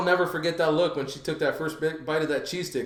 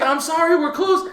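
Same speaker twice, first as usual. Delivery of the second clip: mock clerk apology; 12 semitones higher, 6 dB louder than usual. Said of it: "she's like".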